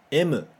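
"M" said as emu, katakana style; Japanese M. Shown as a demonstration. Teacher modelling Japanese "M" for students to repeat. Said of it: "fala depois de mim".

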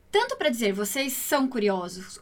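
normal talking voice

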